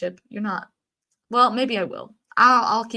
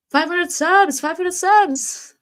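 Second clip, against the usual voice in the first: high, louder celebratory chant; excited cheer; 8 semitones higher, 5 dB louder, slower than usual. Real, not acted. Streamer celebrating five hundred subs, high excited cheer. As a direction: cheer it out, stretching the second one.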